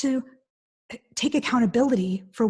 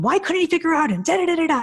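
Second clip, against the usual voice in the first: imitating an exasperated wife, pitched up; mock nagging rant; 7 semitones higher, 3 dB louder than usual. Speaker retells a wife yelling about broken dishes, mock rant. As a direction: rattle off a mock high-pitched tirade. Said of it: "she would go nuts on him".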